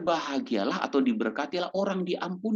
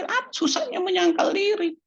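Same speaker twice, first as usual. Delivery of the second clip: light mock shrug. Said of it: "Kan kita bilang".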